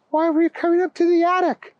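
put-on high, quick child's question; curious boy. Timmy asking grandpa, high and quick. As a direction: rush the question out.

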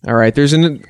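ordinary talk, nothing marked

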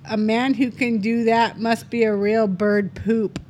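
slow, careful reread of the punchline; slow and deliberate. Shown as a demonstration. Rereading the punchline slowly so listeners hear it. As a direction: spell it out slowly for the audience. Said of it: "Say it again so people can hear".

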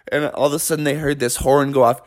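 ordinary delivery, nothing marked

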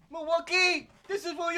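high, loud arena call; hype call-out. Acted. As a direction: shout it to the fans.

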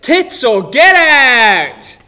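slow drawn-out shout; bellowed slogan. Acted. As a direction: bellow it like a game show catchphrase. Audience rule bellowed.

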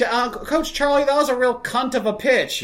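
put-on young player innocently cursing to her coach; young girl. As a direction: chirp it like a little softball player. Girls quoting to coach.

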